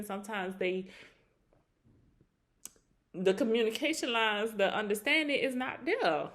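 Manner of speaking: ordinary delivery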